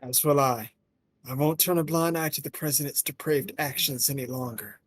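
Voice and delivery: lightly voiced determined character. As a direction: vow it with quiet resolve. Voices game character dialogue.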